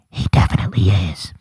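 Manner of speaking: low conspiratorial whisper; whispered aside. Real, not acted. Host whispering a knowing aside to viewers about Trump, in a whisper. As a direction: lean in and whisper it.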